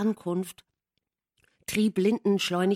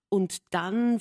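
ordinary delivery

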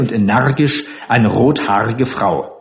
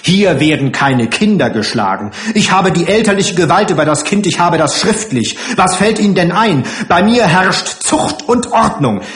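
raised, forceful, hurried protest; indignant self-defence; 6 semitones higher, 3 dB louder than usual. Acted. Matron denies hitting children, indignant and increasingly heated. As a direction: assert authority with mounting heat.

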